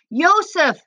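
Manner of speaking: high, desperate call of a name; crying out. A narrator's reading. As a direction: cry out to someone being held away. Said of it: "she cried".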